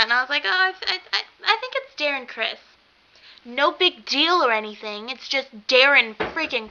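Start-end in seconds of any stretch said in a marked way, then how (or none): 0.4-2.6 high, halting starstruck reply; retold nervous, high-pitched stutter; starstruck stammer. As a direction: stammer it out high, barely believing it. Retold stammered reveal of a star.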